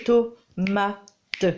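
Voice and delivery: "Tomate" in clear separate beats; "Tomate" said slowly beat by beat; syllables clapped out. Shown as a demonstration. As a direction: clap out each syllable of "Tomate". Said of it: "J'ai claqué combien de fois".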